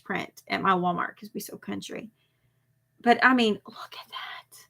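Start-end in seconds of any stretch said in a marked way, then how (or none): 3.7-4.6 whisper. Real, not acted. Showing print on phone.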